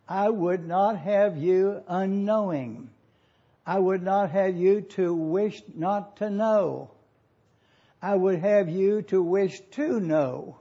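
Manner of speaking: slow, deliberate reading of the verse; slow scripture paraphrase. Shown as a demonstration. Pastor reading the verse to his flock, slow and deliberate. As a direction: lay each phrase down slowly so they catch it. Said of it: "So you see the difference?".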